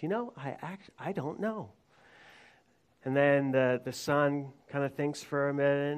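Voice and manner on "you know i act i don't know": lightly voiced dad, softer and a bit higher; stumped father. Father answers his son's question on a fishing trip, mildly stumped. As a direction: quietly admit it, as a puzzled father. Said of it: "the father looks at him and goes".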